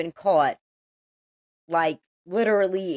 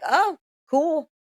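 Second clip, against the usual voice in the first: a much higher, breezy, unbothered "Oh, cool."; mock casual; 9 semitones higher than usual. Staff waving the gunman through, mock casual. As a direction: shrug it off like nothing's wrong.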